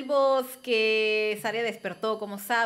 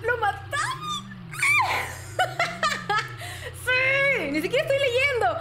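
high, laughing victory cry; triumphant shout; 12 semitones higher than usual. Real, not acted. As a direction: celebrate the win with a scream. Boss defeated, triumph.

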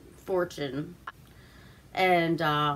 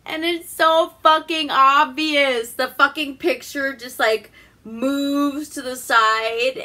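high, loud, incredulous rant; loud exasperation; 6 semitones higher, 7 dB louder, slower than usual. Real, not acted. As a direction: stretch it out in real exasperation. Exasperated at an obvious plot device.

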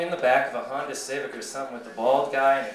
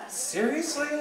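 incredulous comeback; 10 semitones higher, 6 dB quieter than usual. Acted. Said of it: "I look at her and I go".

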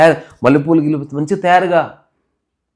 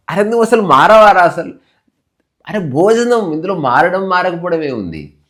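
high, raised, exasperated protest; exasperated quarrel; 6 semitones higher, 6 dB louder than usual. Retold or imitated. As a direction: argue back in mock exasperation. (విసిగిపోయి గొంతు పెంచి వాదన)

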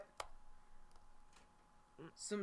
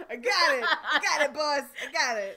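loud, gleeful cry of triumph; excited shout; 3 semitones higher, 10 dB louder than usual. Retold or imitated. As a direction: yell it like you just won. Quoting character's excited cry.

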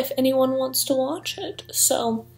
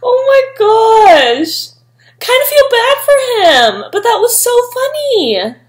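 sympathetic; 10 semitones higher, 4 dB louder than usual. Real, not acted. Pity mixed with amusement.

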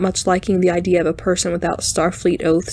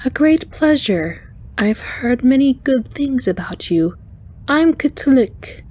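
high-pitched, chiming arachnoid; wind chime voice; 6 semitones higher, slower than usual. A narrator's reading. Alien engineer introducing herself to the captain, wind chime voice. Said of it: "the wind chime voice said".

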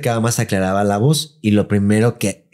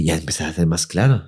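usual speaking voice

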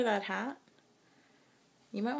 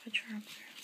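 whispering; 8 dB quieter, faster than usual. Real, not acted. Soft helping toddler dress.

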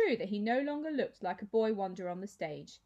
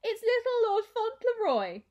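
high, loud mocking girl voice; 13 semitones higher, 8 dB louder than usual. A narrator's reading. Twin taunting Clovis at dinner, high mocking jeer. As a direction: jeer at him in a shrill schoolgirl taunt.

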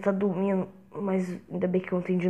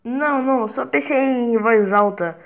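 flustered; 3 semitones higher, 7 dB louder than usual. Acted. Caught thinking aloud, covering.

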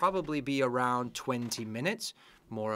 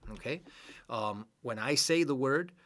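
ordinary talk

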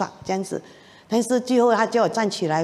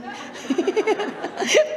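nervous giggle; 10 semitones higher than usual. Real, not acted. 笑自己的害怕.

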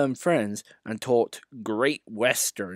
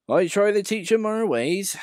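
modest; 10 semitones higher, 4 dB louder than usual. Acted. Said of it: "said Duck modestly".

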